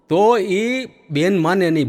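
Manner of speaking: ordinary talk, nothing marked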